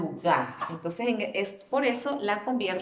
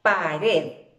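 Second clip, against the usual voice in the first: "pared" loud and lower, firm on "red"; stress on last syllable; 4 semitones lower, 5 dB louder than usual. Shown as a demonstration. Teacher asking pupils where the stress falls, "pared" stressed on "red". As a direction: push the voice onto "red" in "pared". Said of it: "dónde hago mi fuerza de voz".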